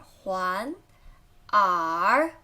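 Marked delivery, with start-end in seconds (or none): 1.5-2.3 耳 drawn out, low and dipping; slow third-tone demonstration of 耳. Shown as a demonstration. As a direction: dip slowly through 耳. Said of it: "third tone".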